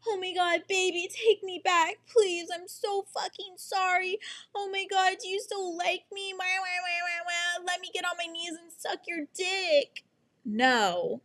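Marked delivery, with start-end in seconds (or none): 0.1-10.0 high, whiny, pleading mock voice; much higher, hammed-up sobbing plea; mock begging girl. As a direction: parody a desperate ex begging to be taken back. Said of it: "It's not like I came over here being like".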